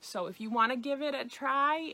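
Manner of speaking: ordinary talk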